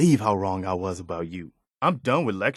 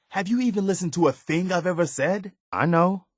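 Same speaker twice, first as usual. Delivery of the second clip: higher and pushing, fed up; frustrated plea that tips into anger; exasperated outburst; 8 semitones higher than usual. Acted. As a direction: demand to be heard, voice climbing. Exasperated companion confronting player.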